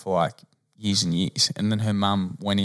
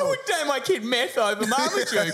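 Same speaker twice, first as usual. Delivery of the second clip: laughing. Real, not acted. Joining the laughter.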